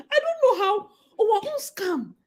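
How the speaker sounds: amused despair; laughing disbelief. Real, not acted. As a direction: giggle helplessly at it.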